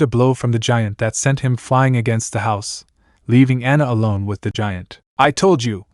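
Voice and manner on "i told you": ordinary delivery, nothing marked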